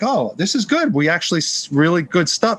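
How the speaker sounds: high, delighted; light up at the good news; positive. Retold or imitated. New saleswoman hearing happy customers, high delighted voice. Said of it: "she got all positive".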